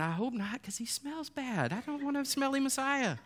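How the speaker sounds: mock disgusted crowd. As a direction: play it as a fussy, grossed-out onlooker. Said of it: "people are going".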